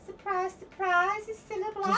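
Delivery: slow, high-pitched celebrity impression. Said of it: "I can do Scylla".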